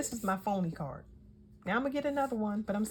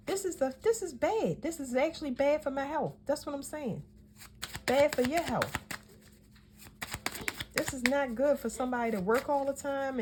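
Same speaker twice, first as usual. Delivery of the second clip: yelling; 5 semitones higher than usual. Real, not acted. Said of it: "I'm sorry for yelling".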